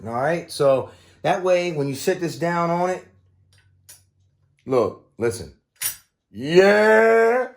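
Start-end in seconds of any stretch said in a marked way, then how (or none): 6.3-7.5 loud, high, triumphant yeah; cheering a perfect fit; excited yell. Real, not acted. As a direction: shout it as the part clicks in. Parts click into place.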